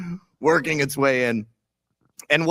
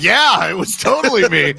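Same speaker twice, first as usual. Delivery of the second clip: higher, louder gleeful yes; gleeful admission; 7 semitones higher, 8 dB louder than usual. Retold or imitated. Daughter telling her mom she caused the win, gleeful. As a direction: own it with glee. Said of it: "I was like".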